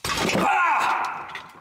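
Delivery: high breathy scream; sudden unvoiced horror shriek.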